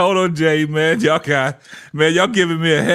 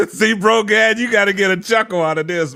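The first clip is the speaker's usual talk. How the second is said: ordinary talk, nothing marked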